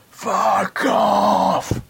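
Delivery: high, loud terrace shout. Retold or imitated. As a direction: bellow it at the top of your lungs. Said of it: "felt obliged to shout".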